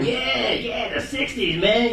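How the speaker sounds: high, soft, drawn-out delivery; softly drawling mock reaction; acted-out reaction. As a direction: stretch it out high and hushed. Acting out another man's reaction.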